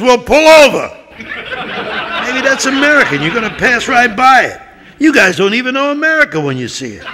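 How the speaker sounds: high, loud frustrated captain; exasperated yelling. Acted. Chris yelling at his crew for sailing past America, high and loud. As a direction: scold the crew for missing it.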